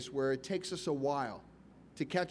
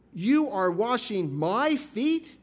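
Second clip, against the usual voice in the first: incredulous; 4 semitones higher, 5 dB louder, much slower than usual. Retold or imitated. Peter shocked at footwashing.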